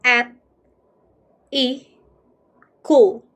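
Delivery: "at. I. Cool." as separate beats, stronger on "Cool"; syllable by syllable. Shown as a demonstration. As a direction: sound out "at", "I", "Cool" with pauses.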